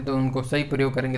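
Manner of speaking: ordinary talk, nothing marked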